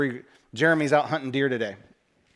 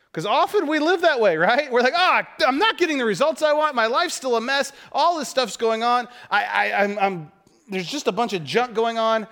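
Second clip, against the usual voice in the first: high-pitched, worked-up complaint; exasperated complaint; 9 semitones higher, 3 dB louder than usual. Acted. Preacher voicing people stuck in failure, exasperated. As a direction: gripe at a higher pitch, words tumbling out.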